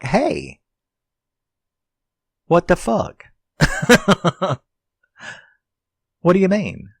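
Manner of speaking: high-pitched, loud protest; indignant disbelief. Retold or imitated. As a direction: exclaim it high, in mock outrage. Teller acts out own indignant reaction.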